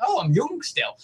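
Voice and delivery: imitating morning self. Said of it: "most days I'll wake up and be like".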